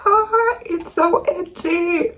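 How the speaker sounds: sliding way up high on ah; a siren sweep, high up. Shown as a demonstration. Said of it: "that was the siren".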